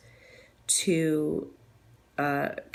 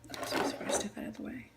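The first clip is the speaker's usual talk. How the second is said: softer, whispered oops; whispered apology; 10 semitones lower, 9 dB quieter, faster than usual. Real, not acted. Whispering sorry to someone in the way of the camera. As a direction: apologize under your breath.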